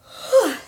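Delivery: long sighing yawn; big yawn. Real, not acted. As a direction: let out a tired yawn. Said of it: "I'm yawning".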